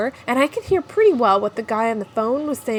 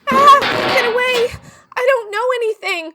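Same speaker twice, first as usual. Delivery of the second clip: high, loud terrified cry; panicked scream; 11 semitones higher, 4 dB louder than usual. Acted. Startled by the shop owner while hiding, terrified scream. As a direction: shriek as if grabbed from behind.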